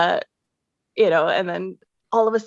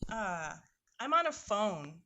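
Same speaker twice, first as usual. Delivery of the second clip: muffled and distant phone sound; speakerphone audio; 11 dB quieter, slower than usual. Real, not acted. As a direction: far from the phone mic. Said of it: "Are you on speaker?".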